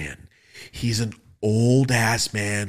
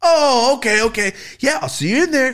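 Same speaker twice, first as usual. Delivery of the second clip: cheerful bailiff; 14 semitones higher, 7 dB louder than usual. Retold or imitated. Bailiff's cheerful reply, retold.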